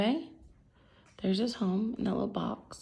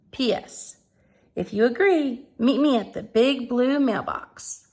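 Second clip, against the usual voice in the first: brighter, louder letter-reading voice; letter read aloud; 3 semitones higher, 5 dB louder than usual. Acted. Teacher reading Mitzi's letter to the class, bright and lively.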